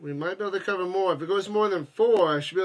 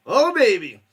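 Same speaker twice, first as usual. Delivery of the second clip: excited; 3 semitones higher, 8 dB louder than usual. Real, not acted. Excited exclamation.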